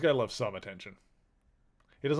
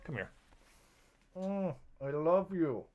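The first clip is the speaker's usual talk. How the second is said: tender pet talk; 4 semitones higher, slower than usual. Real, not acted. Streamer telling her cat she loves him, slow tender coo. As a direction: melt over the cat slowly.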